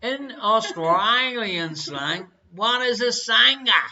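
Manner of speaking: slow, hammed-up Australian accent. Shown as a demonstration. Quizmaster showing his co-host how it goes, in a slow Australian accent. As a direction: put on a drawn-out Aussie accent. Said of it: "How's your Australian accent".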